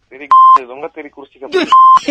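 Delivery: loud, high-pitched angry outburst; angry cursing. Real, not acted. Uncle fed up with chair-wheel joke, angry shouting. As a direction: blow up at the caller. Uncle curses the prank caller.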